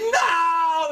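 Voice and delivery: high, loud anguished shout of no; fan's cry of despair. Retold or imitated. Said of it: "I think".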